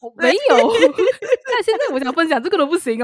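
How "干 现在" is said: high laughing mock swear; laughing curse. Real, not acted. Laughs at being cut off.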